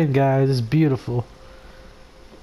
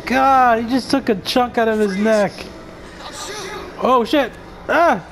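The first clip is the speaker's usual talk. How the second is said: high frightened yelps; panicked cries; 8 semitones higher, slower than usual. Real, not acted. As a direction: genuine panic at the zombie. Zombie attacks, panicked reaction.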